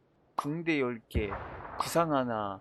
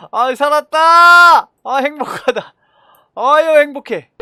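high, loud, slow cry of relief; relieved joy; 10 semitones higher, 14 dB louder, slower than usual. Real, not acted. Gamer exulting after surviving, high and loud. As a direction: savor the survival.

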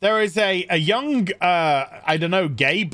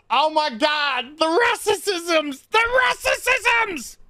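slow, rising higher shriek building; mock horror; 11 semitones higher, slower than usual. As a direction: build a hammed-up horrified cry.

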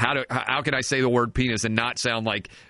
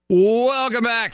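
loud, high, drawn-out greeting; radio welcome-back call; 11 semitones higher, 6 dB louder, much slower than usual. Real, not acted. Host opens the radio segment.

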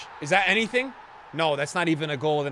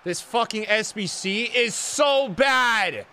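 slow, stretched-out angry shout; angry rant; 3 semitones higher, much slower than usual. Real, not acted. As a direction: drag every word out in disgust. Raging at the challenge.

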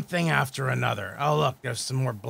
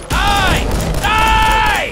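furious scream; 10 dB louder than usual. Real, not acted. Killing the monster.

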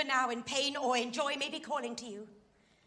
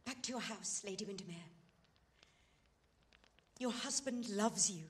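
much softer, a near-hushed plea; soft pleading; 5 semitones lower, 8 dB quieter than usual. Acted. Woman softly urging Lady Windermere home, hushed plea. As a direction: sink the voice low and plead gently.

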